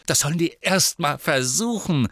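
loud, high scornful jeer; boy mocking the threat loudly; sneering. A narrator's reading. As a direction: sneer it defiantly. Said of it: "höhnte Riddle".

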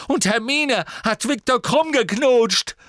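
very high, loud, strained shouting; furious and close to crying; Ginny yelling at Ron on the verge of tears; angry, near tears. Acted. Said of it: "als sei sie den Tränen nahe".

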